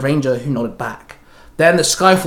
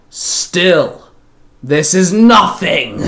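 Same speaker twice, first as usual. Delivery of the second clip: slow, loud gleeful villain sneer; devilish glee; 3 semitones higher, 5 dB louder, much slower than usual. A narrator's reading. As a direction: relish the insult like a devil. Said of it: "Rosfer called out with a devilish glee".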